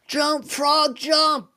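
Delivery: a class shouting at the frog to jump; class screaming. Retold or imitated. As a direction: yell it like a roomful of kids. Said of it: "The whole class is screaming".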